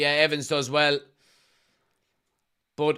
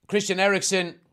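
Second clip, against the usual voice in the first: normal talking voice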